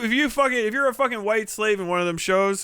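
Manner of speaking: ordinary delivery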